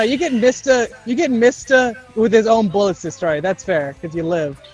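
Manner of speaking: ordinary talk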